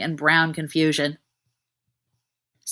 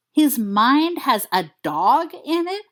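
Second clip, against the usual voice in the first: slow, higher disbelieving question; a touch hysterical; 6 semitones higher, slower than usual. Acted. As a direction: repeat it, a little hysterical. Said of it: "only a little hysterically".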